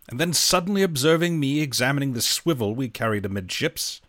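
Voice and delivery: plain reading voice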